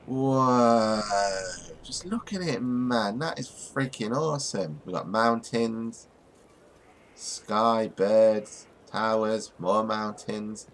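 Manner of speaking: high, awestruck and slow; excited awe. Real, not acted. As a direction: gasp at the view, amazed. Awed by game scenery.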